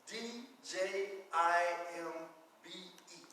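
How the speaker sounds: slow letter-by-letter spelling; teacher spelling for children. Shown as a demonstration. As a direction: spell it out slowly, one letter at a time. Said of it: "Sometimes you'll see it spelled".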